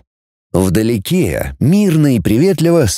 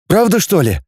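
higher, pleased question; delighted; 6 semitones higher than usual. A narrator's reading. Boy asks if patients really recover, delighted. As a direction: check the good news happily. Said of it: "обрадовался Кнуров".

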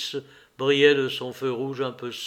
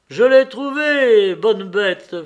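much higher and louder, slow and glad; joyful praise; 7 semitones higher, 10 dB louder, slower than usual. A narrator's reading. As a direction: cheer the dog for finding the bird. Levin praising the dog.

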